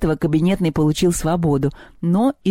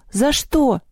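high, baffled query; puzzled, pitched-up disbelief; surprised question; 9 semitones higher than usual. A narrator's reading. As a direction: ask in astonishment. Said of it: "удивился Севка".

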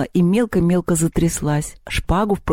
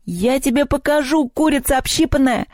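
high-pitched furious threat; angry yell; 9 semitones higher than usual. A narrator's reading. Girl charging at the bird leader, angry yell. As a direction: go for them in a high, indignant cry. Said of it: "закричала она".

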